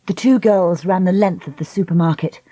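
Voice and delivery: usual speaking voice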